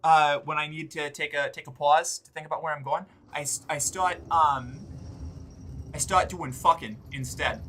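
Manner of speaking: Boston accent pitched a touch up. Shown as a demonstration. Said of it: "when I talk like this with the Eastern accent".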